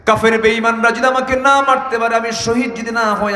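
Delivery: high, impassioned sermon chant; full-force preaching. Real, not acted. Preacher voicing Abdullah's protest to the Prophet, impassioned preaching.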